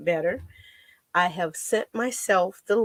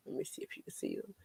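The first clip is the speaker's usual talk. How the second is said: whispering; 20 dB quieter, faster than usual. Real, not acted. Quick whispered aside.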